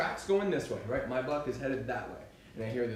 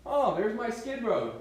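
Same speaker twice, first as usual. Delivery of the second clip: sudden "Oh, there's my skid road" pitched up and louder; sudden realisation, high and louder; realising surprise; 9 semitones higher, 6 dB louder than usual. Retold or imitated. Hunter realising where he is, raised surprised voice. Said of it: "I was like".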